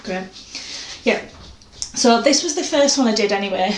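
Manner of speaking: breathless after rushing back; panting a little between words; out of breath. Real, not acted. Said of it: "I'm out of breath".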